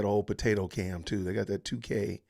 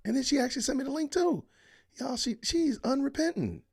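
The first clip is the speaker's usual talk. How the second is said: high-pitched complaint; 4 dB louder than usual. Real, not acted. Streamer blaming a viewer for the link, high-pitched complaint. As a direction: voice shooting up high in protest.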